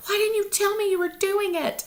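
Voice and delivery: high, mock-hurt question; indignant sister. Sister asking why she kept the program secret, high playful squeal. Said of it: "my sister was like".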